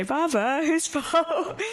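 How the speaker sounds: high, laughing mock reproach to her dad, half-shouted; laughing retort. Daughter teasing her father over not speaking Somali, laughing half-shout. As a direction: tease him with a playful laughing jab. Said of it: "which we say".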